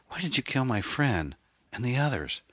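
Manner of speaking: high-pitched, anguished demand; distressed question. A narrator's reading. Anne confronts her friend's killer, high and distressed.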